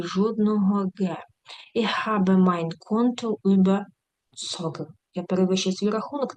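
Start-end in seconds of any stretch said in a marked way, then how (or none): 1.7-4.9 slow, careful German example; slow demo read. Shown as a demonstration. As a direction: read the example slowly for students. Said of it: "example sentence read slowly". (повільне читання прикладу)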